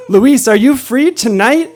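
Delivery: high, loud, drawn-out question; excited invitation. Retold or imitated. Storyteller inviting his driver friend out tonight, excited. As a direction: call it out slowly and eagerly to the crowd.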